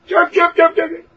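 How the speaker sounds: high, quick chirps; bird chirping. Retold or imitated. Teacher mocking a mindless bird-like prayer, high chirps.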